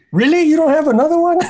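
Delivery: high disbelieving squeak; incredulous. Retold or imitated. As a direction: sound stunned and incredulous. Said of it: "I was like".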